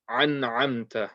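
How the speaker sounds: slow "an amta", throat ain opening both words; both read as ain. Shown as a demonstration. Teacher shows what people might say, both as ain. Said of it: "both of them being read as ain".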